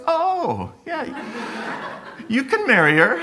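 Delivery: high, laughing mock approval; laughing imitation. Imitating Julie's dad giving his blessing, high and laughing. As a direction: toss off the blessing through laughter. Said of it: "And he goes".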